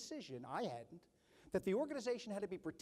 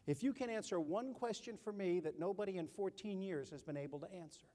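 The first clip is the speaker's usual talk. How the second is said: usual speaking voice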